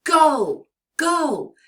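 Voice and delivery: "go" called out high and loud; a teacher modelling a big bold "go"; emphatic go demo. Shown as a demonstration. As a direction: belt out "go, go" as a model for the kids. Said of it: "So try saying go".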